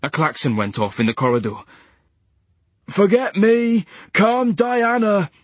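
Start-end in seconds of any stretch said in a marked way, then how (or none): 2.9-5.3 snapped order. A narrator's reading. Nurse snapping at the orderlies. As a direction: bark the order. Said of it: "snapped Barney".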